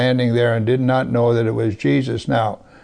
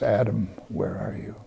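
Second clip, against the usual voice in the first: soft calling; 4 semitones lower, 11 dB quieter than usual. Acted. God calling Adam in Genesis.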